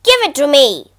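loud barking order; forceful, snapped-out dog command; barked demand. A narrator's reading. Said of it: "barked the dog on the bridge".

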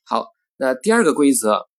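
ordinary delivery

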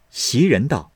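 ordinary delivery, nothing marked

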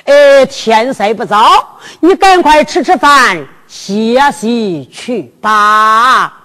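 slow, stretched-out elder's voice; stage old man bidding goodnight; old father's kindly send-off. Acted. Old father sends son to bed.

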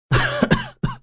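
short high scoffing laugh; a sharp, high sneer of a laugh; high mocking laugh. Fighter laughs mid-fight.

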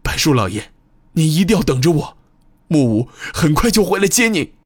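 resolute promise, rising high and fast; firm, determined. A narrator's reading. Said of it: "声音变得无比坚定".

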